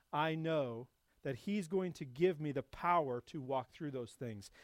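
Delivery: ordinary delivery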